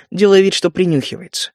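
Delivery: plain reading voice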